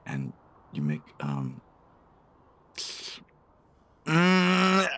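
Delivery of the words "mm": laughing. Acted. Teasing build-up to a joke.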